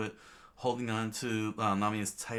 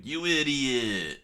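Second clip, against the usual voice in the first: mild mock yell; 6 semitones higher, 5 dB louder, slower than usual. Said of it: "Luffy looked back screaming".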